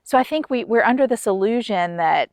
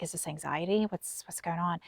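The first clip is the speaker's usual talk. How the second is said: much softer, lower anxious asking; worried question; 3 semitones lower, 14 dB quieter than usual. Retold or imitated. Wife retelling asking her doctor, hushed and anxious. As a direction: ask the doctor quietly, unsure. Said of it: "I'm like".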